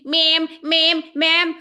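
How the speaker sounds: tip the hat with each ma'am; mock southern ma'am. Mocking townsfolk who keep saying ma'am. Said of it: "Everyone goes around saying".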